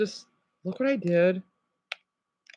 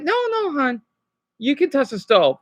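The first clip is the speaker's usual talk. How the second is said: mock gentle coaxing; 5 semitones higher than usual. Mock soft coaxing of child.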